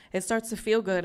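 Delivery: normal talking voice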